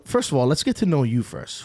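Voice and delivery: usual speaking voice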